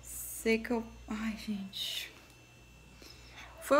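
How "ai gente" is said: breathy whispered "ai, gente"; whispered sigh. Real, not acted. To viewers after a horrible dyeing session, a whispered sigh.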